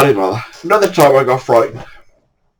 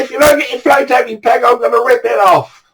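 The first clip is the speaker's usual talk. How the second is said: fast, high shouted threat; quick, much higher threat; angry threat; 10 semitones higher than usual. Retold or imitated. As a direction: warn him, losing patience. Threatening the other angler.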